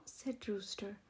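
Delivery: usual speaking voice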